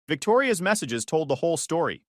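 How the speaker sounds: usual speaking voice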